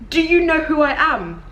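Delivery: high, lightly mocking imitation; mock self-important question. Repeating the question that causes the giggles, mock self-important. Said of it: "that question makes me giggle".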